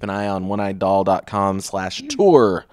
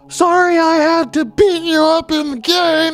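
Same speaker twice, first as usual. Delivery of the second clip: put-on shrieky, very high, slow kid voice; little kid; 10 dB louder than usual. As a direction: squeal it out loudly as a tiny kid. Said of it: "little kid comes up".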